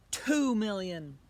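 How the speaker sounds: slow, firm offer from the archduke. Acted. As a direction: draw out the bid. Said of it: "he said".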